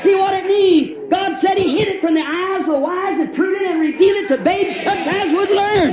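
high, drawn-out shouted preaching; full-force revival preacher; impassioned preaching. Real, not acted. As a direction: cry it out to the congregation, stretching line endings.

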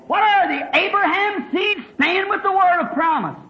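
high-pitched preacher's challenge; fervent preaching. Real, not acted. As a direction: cry out the question to the congregation. Preacher cries out a question.

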